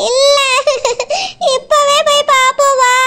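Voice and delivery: hammed-up sobbing refusal; fake crying. Wife refusing to wait until evening, fake crying. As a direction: wail and sob like a stubborn child. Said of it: "நீ அழாத".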